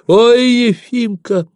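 very high, happy exclamation; high-pitched joyful call; overjoyed cry. A narrator's reading. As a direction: cry out with delighted relief. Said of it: "закричала обрадованная мать".

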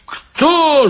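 high-pitched outraged yell. A narrator's reading. Drunk guard challenging the maestro, high yell. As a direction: shoot the pitch up as a yell, without full volume. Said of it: "заорал стражник".

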